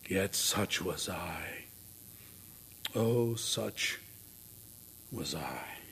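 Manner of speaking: very low, slow, soft ghostly admission; hushed confession. Acted. Dickens' phantom admits his own abused life, hushed regret. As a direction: sink into hushed, halting regret.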